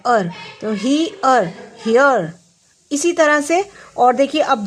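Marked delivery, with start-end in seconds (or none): 0.1-2.3 sounding out slowly. Shown as a demonstration. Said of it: "आपको कैसे सिखाना है बच्चों को".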